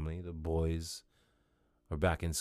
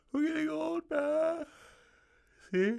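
much higher, put-on croaky complaint; squeaky high old-man voice; 5 dB louder than usual. Repeats phrase in new voice.

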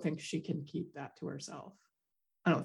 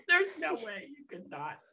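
higher pitch, breaking into laughs; amused incredulity; laughing disbelief; 11 semitones higher than usual. Real, not acted. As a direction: insist through laughter.

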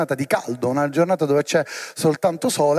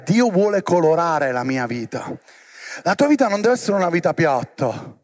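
ordinary delivery

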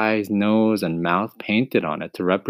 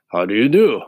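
husky scarecrow greeting, louder; a friendly straw man asks; 3 semitones higher, 5 dB louder than usual. Acted. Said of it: "answered the scarecrow".

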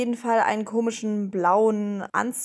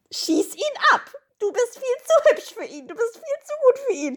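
hyped best friend; 14 semitones higher than usual. Real, not acted. Cheering on a heartbroken girl.

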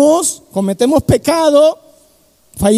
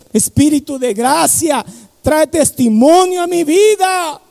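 fervent cry; 5 semitones higher than usual. Shown as a demonstration. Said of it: "Vamos a clamarle".

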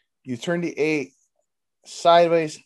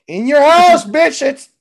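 high and loud promo call; event hype shout; 8 semitones higher, 7 dB louder, slower than usual. Pretend. Hyping the wrestling event, loud high promo shout.

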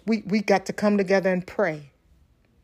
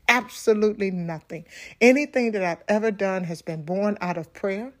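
normal talking voice

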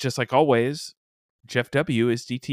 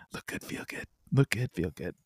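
quiet warm-up mutter; 6 semitones lower, 8 dB quieter than usual. Shown as a demonstration. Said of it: "Here we go".

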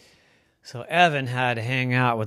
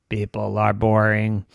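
low, even robot drone; 5 semitones lower than usual. Acted. Robot rejecting Evan's suggestion, monotone robot voice. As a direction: dismiss it in a deadpan robot voice.

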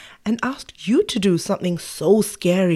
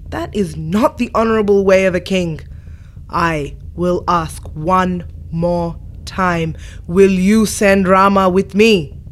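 loud, thundering scolding; booming angry rebuke; 4 dB louder than usual. Acted. Angry sage scolds the king for breaking his word, booming. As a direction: roar it down at a cowardly king. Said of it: "His voice boomed out".

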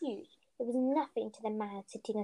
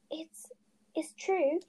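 faltering; 6 semitones higher, much slower than usual. A narrator's reading. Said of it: "faltered Professor McGonagall".

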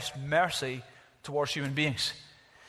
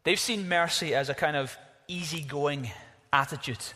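ordinary talk, nothing marked

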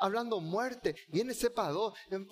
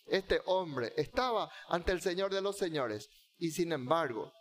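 normal talking voice

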